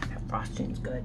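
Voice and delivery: whispered, low, savouring. Real, not acted. Vlogger whispers her verdict on the frosting. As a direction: breathe it out between bites. Tasting cookie mid-chew.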